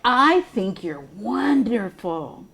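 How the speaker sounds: reading a picture book to preschoolers; gentle sing-song read-aloud; storytime voice. Acted. Reads picture book aloud to children.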